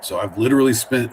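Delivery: ordinary talk, nothing marked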